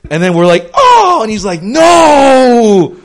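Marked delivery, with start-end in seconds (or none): 0.7-1.2 excited gasp. Retold or imitated. People marveling at a puppy. 1.6-3.0 mock shout. God voiced reacting in a story.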